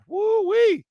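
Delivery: high excited whoop; excited woo-wee. Real, not acted. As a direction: whoop with delight at the signing.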